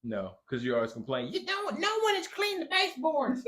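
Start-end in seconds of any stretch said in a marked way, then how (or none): 1.3-3.4 high-pitched Southern complaining, imitated; mock your wife's complaint in a silly Southern voice; much higher put-on Southern twang; weird Southern accent. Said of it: "giving you a weird Southern accent".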